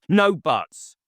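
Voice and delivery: a loud angry snap; sharp with anger. A narrator's reading. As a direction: cut in sharply. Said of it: "his voice rising sharp with anger".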